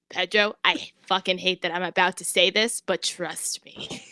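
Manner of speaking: low, hushed plea for trust; quiet reluctant confession. Acted. Rigatoni looks Pedro in the eyes, quiet and reluctant.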